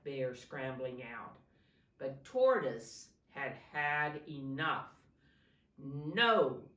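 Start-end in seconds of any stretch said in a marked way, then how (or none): 5.8-6.7 loud spluttering no; sputtering no. A narrator's reading. Tortoise sputters after being pulled into the pond, loud. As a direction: cough it out after the dunk. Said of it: "he sputtered".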